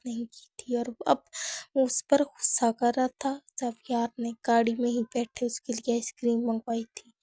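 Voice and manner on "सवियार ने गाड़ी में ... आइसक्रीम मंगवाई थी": usual speaking voice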